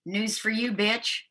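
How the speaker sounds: ordinary talk, nothing marked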